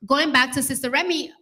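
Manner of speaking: normal talking voice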